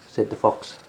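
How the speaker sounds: usual speaking voice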